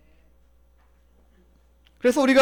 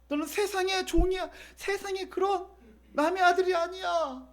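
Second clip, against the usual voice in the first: high-pitched but softer, fervent father; acted fatherly pleading; 9 semitones higher, 6 dB quieter than usual. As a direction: insist tenderly in a raised pitch. Father reassures his returned son. (높은 음성으로 애절하게)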